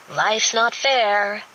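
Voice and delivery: a bright little jab, drawn out; cheerfully acerbic. A narrator's reading. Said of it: "in a cheerfully acerbic voice".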